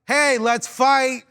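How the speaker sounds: loud, high, slow call-out; mock fighting challenge. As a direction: throw down a challenge, drawn out. Mock challenge he didn't actually shout.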